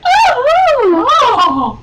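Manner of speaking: high, breathy-sounding put-on princess sighs; mock swooning. Cohost imagines the princess in a limo, high mock gasps. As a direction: gasp like a delighted princess in a limo. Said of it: "is all like".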